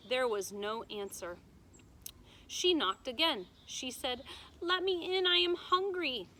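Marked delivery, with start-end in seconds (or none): 4.6-6.3 higher, louder begging voice; pleading princess. A narrator's reading. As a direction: beg to be let in from the cold. Princess begs again.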